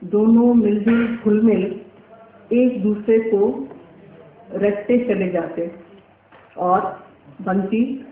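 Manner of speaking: measured recital with long pauses between phrases; poem recitation. Acted. Painter reciting her short poem to the audience, measured poetic recital. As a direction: recite it line by line, lifting each opening phrase.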